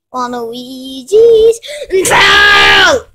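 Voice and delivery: big climactic yell of the name; slow shout. Acted. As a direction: bellow the name out slowly.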